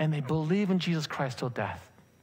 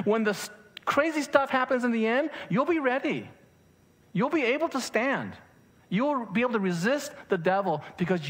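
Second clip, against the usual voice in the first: rousing preaching; 6 semitones higher than usual. Acted. Rousing climax about standing firm.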